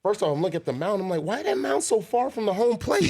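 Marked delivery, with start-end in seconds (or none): 1.2-3.1 high-pitched mock-baffled question; baffled disbelief. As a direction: ask it high, as if it makes no sense. Retold baffled reaction to the mound.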